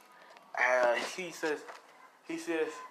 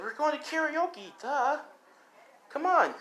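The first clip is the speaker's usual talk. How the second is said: much higher, eager and teasing; bubbly girl voice; 13 semitones higher than usual. Acted. Mina dragging him off to karaoke, high and bubbly. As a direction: pull him along, delighted. Mina excitedly urging Dave.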